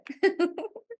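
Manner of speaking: high-pitched little laugh. Real, not acted. Teacher after asking about intuition today, laughing. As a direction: let out an amused giggle. Amused exchange with student.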